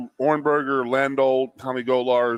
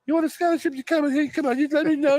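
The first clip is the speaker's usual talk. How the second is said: much higher put-on old coach voice; Joe imitation. Coach offering a scholarship, much higher put-on voice. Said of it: "Joe's like".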